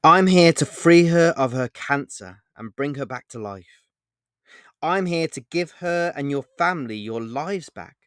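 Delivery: loud, earnest promise of rescue; mean every word, full voice; sincere. A narrator's reading. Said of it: "with a look of complete and utter pure sincerity".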